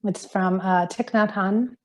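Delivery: usual speaking voice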